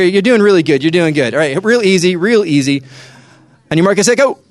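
ordinary delivery

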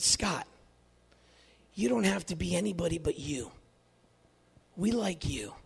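softly, down to a whisper at the end; quiet and kind, almost whispering; gentle, hushed. Retold or imitated. Friend sits Scott down and tells him gently, hushed.

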